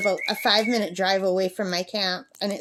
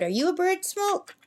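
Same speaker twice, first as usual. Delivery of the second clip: much higher, cooing question to the parrot; teasing pet talk; 9 semitones higher than usual. Real, not acted. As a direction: coo it up high at your bird.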